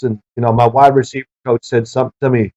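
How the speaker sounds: ordinary delivery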